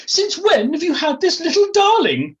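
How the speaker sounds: high-pitched, loud upper-class lady; Felicity Forsyth Twike. Acted. Posh lady asking her friend about the new dog, high-pitched. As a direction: coo it in a piercingly high posh squawk. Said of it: "in her high-pitched voice".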